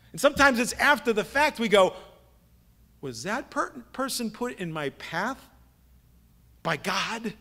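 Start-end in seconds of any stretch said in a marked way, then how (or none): 3.0-7.3 softly puzzled self-questioning; hushed wondering. Acted. Believer wondering after the fact, softly puzzled.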